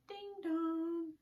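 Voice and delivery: bright two-note doorbell chime; doorbell ding dong. Shown as a demonstration. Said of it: "Can you say ding dong for the doorbell?".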